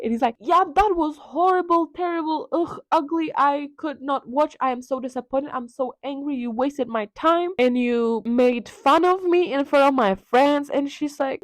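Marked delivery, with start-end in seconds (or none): 0.4-10.7 higher-pitched, acted indignant rant; mock disgusted scolding. Dorian berating Sybil after her play, mock indignation. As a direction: list the insults with playful disgust.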